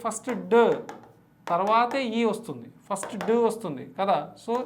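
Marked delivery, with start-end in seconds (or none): 0.5-0.6 "డ" as a lone, firm consonant sound; first sound isolated. Shown as a demonstration. Teacher asking students to pronounce DEO, first sound isolated. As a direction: sound out just "డ", not the letter name. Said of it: "ఇది పలకండి".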